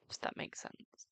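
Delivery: soft breathy whisper into the mic; ASMR whisper. Shown as a demonstration. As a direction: whisper it close to the microphone, ASMR style. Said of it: "I could do A S M R with this microphone".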